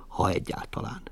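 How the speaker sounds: breathy trailing whisper; a stage whisper closing the speech. Acted. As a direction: let it die away in a whisper. Mother's theatrical monologue ends.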